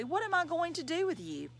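fond scolding with pitch lifted high; mock scolding. A narrator's reading. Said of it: "Ronnie scolded Bradley".

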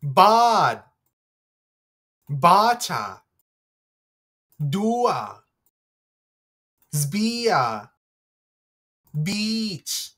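"Bod", "Bacha", "Dua" with long vowels; long vowel words. Shown as a demonstration. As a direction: stretch each vowel in "Zbia" and "Beach". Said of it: "Here are the answers".

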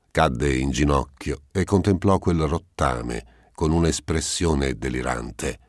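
usual speaking voice

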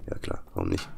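very low, voiceless sarcastic aside. Acted. Talking back to himself in a low sarcastic voice. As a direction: growl it out like a grumpy inner voice.

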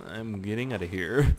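ordinary talk

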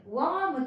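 ワーム with a long open "ah" after the W; mispronounced warm. Shown as a demonstration. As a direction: say warm wrongly as ワーム, like arm. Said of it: "ワームという音じゃなくて".

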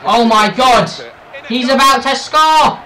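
loud, high rising excitement; thrilled gamer cry; excited shout. Real, not acted. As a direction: yell as a goal seems certain. Chance to score.

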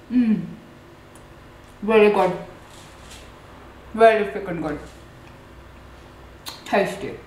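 talking between bites; savoring food while speaking; mouth full, chewing. Real, not acted. Seen 3: bites pastry at -2, chews with closed, rounded mouth through labelled words.

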